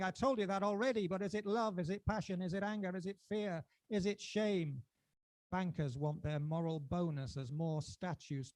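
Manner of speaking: rising and falling spoken-word recital, charged; performance poetry. Acted. Poet reciting his poem to a live crowd, dramatic. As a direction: declaim each line to the audience like a manifesto.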